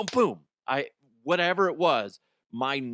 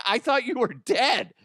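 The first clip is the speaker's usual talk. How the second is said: high, louder astonished cry; retold shock; 11 semitones higher, 4 dB louder than usual. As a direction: blurt it out in disbelief. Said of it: "I was like".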